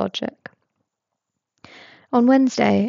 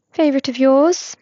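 higher, brighter-pitched curious coworker; pry with cheerful curiosity; nosy coworker Tobias; 5 semitones higher, 6 dB louder than usual. A narrator's reading. Nosy coworker quizzing Regulus at the register, higher and louder.

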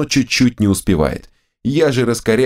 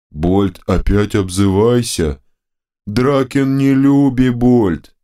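two bickering giant voices; 3 semitones higher, slower than usual. A narrator's reading. Dim left head complains.

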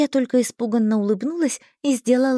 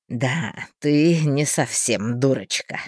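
low, slow smirking man; nasty smile; 7 semitones lower, slower than usual. A narrator's reading. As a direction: say it through a nasty grin. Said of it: "противно улыбаясь".

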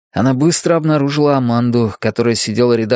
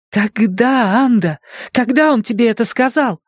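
higher, pressing harder; urgent questioning; 11 semitones higher than usual. A narrator's reading. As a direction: demand an answer again, shaking. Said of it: "Лизе хотелось трясти её".

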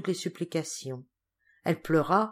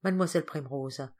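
usual speaking voice

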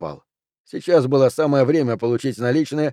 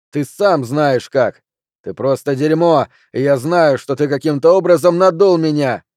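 heated outburst building louder; indignant, raised-voice retort; angry accusation; 3 semitones higher, 5 dB louder than usual. Acted. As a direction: accuse him, rising to a shout. Junior throws accusations back at Flint.

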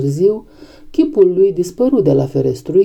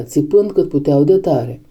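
plain reading voice

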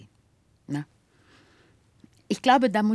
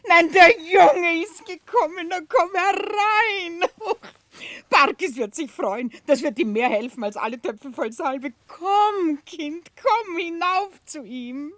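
high, tearful and overjoyed; much higher, louder voice breaking with joy; tearful joyful welcome; 14 semitones higher, 6 dB louder than usual. Acted. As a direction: cry out in happy tears at the boy's return. Peggotty greets long-lost boy.

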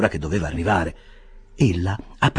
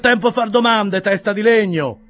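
much higher and louder, scornful bearer's voice; disgusted; 14 semitones higher, 6 dB louder than usual. A narrator's reading. Bearer scolding the supposed servant, loud and disgusted. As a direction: bark it at a fool. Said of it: "rispose l'uomo con gran disgusto".

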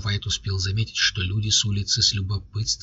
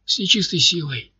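higher startled squeal; 8 semitones higher than usual. A narrator's reading. Ryzhy startled by his own words, high squeal. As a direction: squeak it out in surprise. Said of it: "взвизгнул он".

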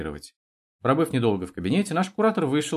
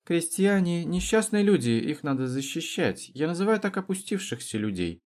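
lightly voiced haughty intellectual; 8 semitones higher than usual. A narrator's reading. Intellectual student justifies himself to Trutnev, mildly lofty. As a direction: explain loftily, lightly in character.

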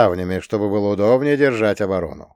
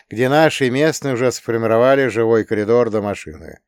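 usual speaking voice

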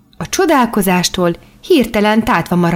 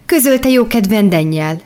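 ordinary delivery

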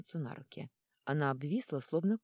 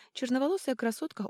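plain reading voice

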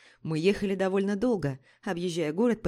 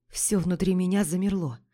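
usual speaking voice

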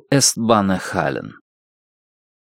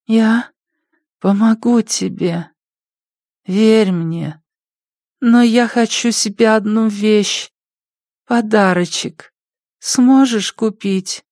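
high, slow wheedling addict; junkie voice; 10 semitones higher than usual. Acted. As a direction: wheedle slowly, high and sly. Junkie bargaining for gift.

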